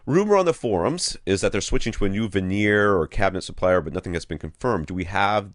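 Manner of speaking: ordinary talk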